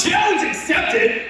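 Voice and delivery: high, slow swaggering challenge; cocky reply. Retold or imitated. Laughing at the farmer's warning, cocky and high. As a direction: accept the dare smugly. Mock bravado.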